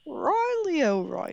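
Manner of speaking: slow, high, sing-song stab at Irish; attempted Irish accent. Co-host copying the character's name, attempted Irish accent. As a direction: stretch the name in a poor Irish lilt. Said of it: "I can't do an accent".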